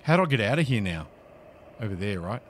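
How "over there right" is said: low and very soft, nearly whispering; quiet murmur under the breath; hushed. Real, not acted. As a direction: keep it down so no one hears. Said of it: "I'm being very quiet".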